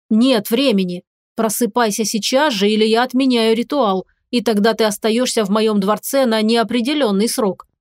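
usual speaking voice

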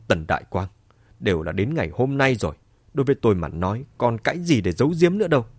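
ordinary delivery

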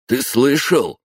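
put-on a young thug's high-pitched question; high male voice. A narrator's reading. Thug asks his friend, in a high, loud male voice. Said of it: "спросил мужской высокий голос".

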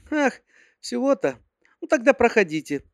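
put-on high girly secretary voice; sweet, high-pitched young secretary; cartoon princess. A narrator's reading.

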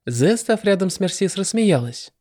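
usual speaking voice

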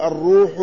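"الروح" said ar-ruuhu, long u; damma on ra. Shown as a demonstration. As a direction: round the vowel of "الروح" after the r. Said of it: "بضم الراء".

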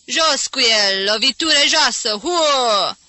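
loud heckler's yell from the crowd; angry ringside jeer, loud and high; shouting. A narrator's reading. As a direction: shout it in outrage, ending on a drawn boo. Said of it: "Cineva țipă în spatele lui Jim".

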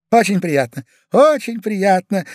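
high, loud politeness; effusive greeting. Acted. Husband shakes the admirer's hand, flustered and effusive. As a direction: overdo the pleasantry, stretching the repeat.

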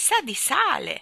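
high, loud, drawn-out exclamation; loud cry. A narrator's reading. Boy tastes sea water, crying out loudly. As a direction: cry out in surprise, stretching it. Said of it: "gridò forte".

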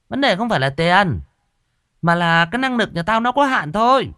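high, loud, bossy warning; stern shaman. Acted. Shaman warning the old woman of ghosts, high and bossy. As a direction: talk down to the client.

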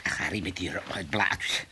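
slow, soft whisper; slow hushed whisper. Acted. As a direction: breathe it softly, moved. Tender recollection, whispered.

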